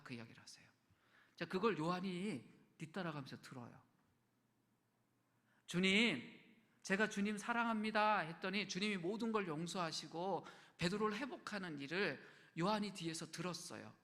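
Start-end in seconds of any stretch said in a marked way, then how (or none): 5.7-8.2 loud declaration. Retold or imitated. Pastor voicing Peter to Jesus, loud declaration. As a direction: proclaim it loud to the whole room.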